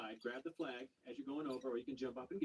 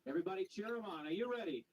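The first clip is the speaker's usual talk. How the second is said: ordinary talk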